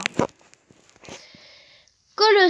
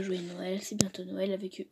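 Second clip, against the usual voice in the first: whisper; 5 semitones lower, 12 dB quieter than usual. Real, not acted. Reading the holiday greeting aside.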